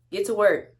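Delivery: loud, high bossy calling of the name; loud mocking manager voice; imitating the manager. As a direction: mimic the manager calling you out. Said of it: "And then she got".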